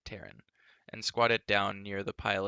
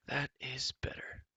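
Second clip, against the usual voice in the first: quiet; 4 semitones higher, 4 dB quieter, slower than usual. Acted. Ellidyr sated, soft.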